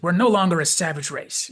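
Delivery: imitated defiant objection; angry retort. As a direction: shoot it back in mock anger. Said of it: "shoots back with this angry retort".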